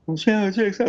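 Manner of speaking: high-pitched imitation of a comedian's voice; teasing high-voiced mimicry; Jerry Seinfeld. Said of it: "like a little Jerry Seinfeld".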